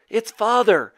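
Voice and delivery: high, loud outburst; urgent revelation. A narrator's reading. Boy telling his mother who the dragon is, distressed. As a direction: blurt out the awful news.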